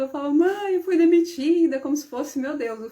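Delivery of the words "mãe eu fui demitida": higher, louder mock tearful wail; a touch of mock sobbing to mom; retold crying. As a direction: replay the teenage despair, half-hammed. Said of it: "só chorava".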